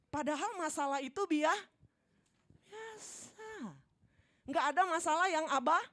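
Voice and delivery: loud, high preaching with soaring word ends; full-force preaching. Acted. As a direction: preach it out, building each line. (berkhotbah dengan berapi-api)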